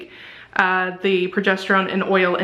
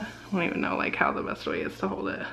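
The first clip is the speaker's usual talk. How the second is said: quiet aside; 12 semitones lower, 10 dB quieter than usual. Real, not acted. Fumbling with test on camera.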